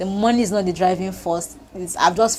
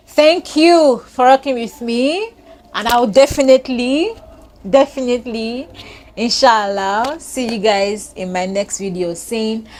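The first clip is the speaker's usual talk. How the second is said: higher, louder, slow beaming thanks; excited sign-off; 4 semitones higher, 6 dB louder than usual. Real, not acted. Vlogger thanking followers, excited and loud. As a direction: sing out your thanks to the fans.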